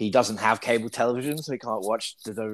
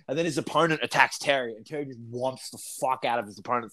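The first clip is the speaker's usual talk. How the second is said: ordinary talk, nothing marked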